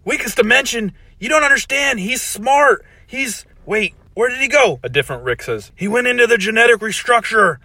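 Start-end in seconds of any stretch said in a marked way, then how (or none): 0.0-4.8 put-on worried old scientist. Original Rick warns. 5.8-7.5 mocking Rick voice. Rick joking.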